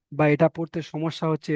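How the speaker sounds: normal talking voice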